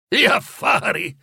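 high, slow incredulous question; exasperated echo. A narrator's reading. Ghost repeats Scrooge's praise of business, exasperated. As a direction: throw the words back, drawn out and high. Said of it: "alzando gli occhi al cielo".

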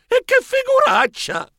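hurt groan rising to high embarrassment; pained wail. Acted. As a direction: wail in pain and humiliation after falling. Protagonista caduto a terra si lamenta. (lamento dolorante, poi acuto)

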